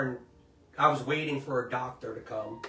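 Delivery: usual speaking voice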